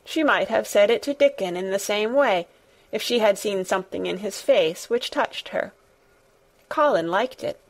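ordinary delivery, nothing marked

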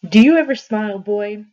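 loud, pushy demand. A narrator's reading. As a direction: demand it loudly, leaning in. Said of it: "he demanded".

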